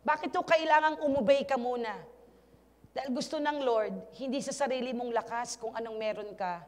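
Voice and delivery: ordinary talk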